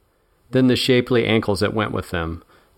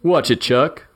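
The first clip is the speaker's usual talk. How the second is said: irritated snap; 6 semitones higher than usual. Acted. Hurt thug snaps at partner.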